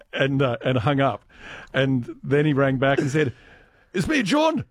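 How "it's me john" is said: put-on Glaswegian accent. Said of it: "I can't do John's accent".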